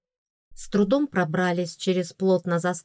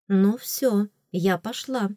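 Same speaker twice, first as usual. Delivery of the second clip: ordinary delivery, nothing marked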